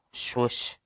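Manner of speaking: whispered swoosh of a turning page. A narrator's reading. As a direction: breathe out a page sound. Page-turning sound effect.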